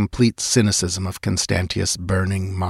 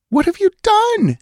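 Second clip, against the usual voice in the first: alarmed outcry; 6 dB louder than usual. Acted. Queen cries out in alarm.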